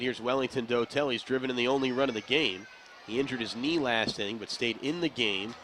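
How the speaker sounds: measured radio baseball call; play-by-play. Acted. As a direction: call the game live on air.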